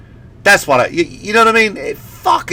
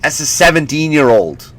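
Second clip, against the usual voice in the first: ordinary talk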